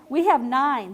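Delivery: ordinary delivery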